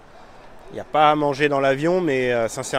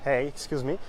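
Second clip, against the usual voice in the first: soft, halting English with a French accent; 7 dB quieter than usual. Real, not acted. French vlogger asking a local about [name]'s star, French-accented English. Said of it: "mon accent anglais, est à toute épreuve".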